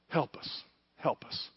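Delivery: hushed pleading whisper; whispered plea in a retold prayer. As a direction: breathe the plea to God.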